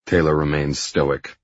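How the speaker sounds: usual speaking voice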